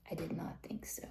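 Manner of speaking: breathy whispered scoff; a pointed whispered scoff; whispered aside. Real, not acted. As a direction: drop to a whisper for the punchline. Rhetorical point, dropped to a whisper.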